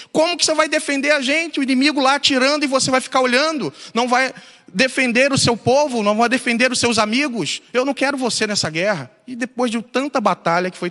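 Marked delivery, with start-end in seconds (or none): 0.1-9.1 high, insistent mock tirade; acted anger. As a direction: confront him like an outraged comrade. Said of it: "Os amigos ficaram com raiva dele".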